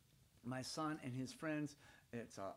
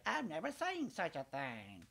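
higher, slow prissy lady's catchphrase; Miss Sally Lynette voice; 3 semitones higher, 3 dB louder than usual. Retold or imitated. The boy provokes Miss Sally Lynette, higher and slow. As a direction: draw it out like a scandalised lady. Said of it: "make her say".

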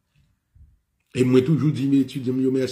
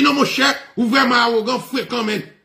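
agitated protest; 10 semitones higher than usual. Retold or imitated. Student calling the speaker arrogant, agitated. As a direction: imitate a worked-up man accusing someone. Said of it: "agité".

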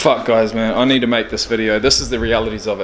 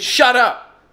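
higher, sharp mock snap; retold angry snap; 10 semitones higher than usual. Ex snapping at him over the car keys, retold angry snap. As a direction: throw a curt shut up back at him. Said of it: "she said".

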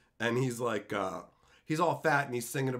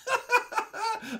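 high burst of laughter. Real, not acted. Laughing at own joke.

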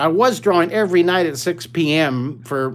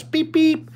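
bus horn beeps; 12 semitones higher than usual. Pretend. Teacher honks the stress bus.

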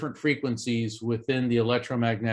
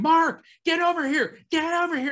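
urgent calling; 3 dB louder than usual. Retold or imitated. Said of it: "I'm hearing".

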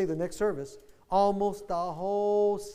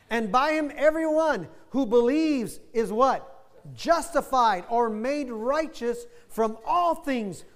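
raised, louder preaching read with slow pauses; preaching the scripture; 4 semitones higher, 4 dB louder, slower than usual. Acted. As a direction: proclaim it to the congregation. Continues preaching the verse.

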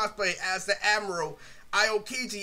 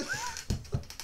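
short squeaky laugh; a quick giggle; real laughter, very high; 9 dB quieter than usual. Real, not acted. Laughing after the story.

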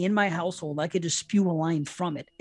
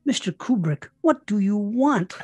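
quoting [name]. Retold or imitated. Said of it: "reported to have said".

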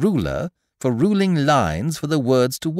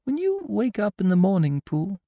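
high, small, gentle voice; Piglet's thoughtful question; 12 semitones higher than usual. A narrator's reading. Piglet walking home with Pooh, high thoughtful voice. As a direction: ask in a high little voice.

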